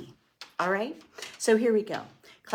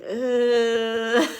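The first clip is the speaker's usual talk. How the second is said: ugh; 3 semitones higher, 6 dB louder than usual. Retold or imitated. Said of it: "tapping on your chest and going".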